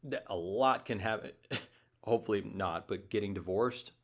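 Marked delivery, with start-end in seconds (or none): none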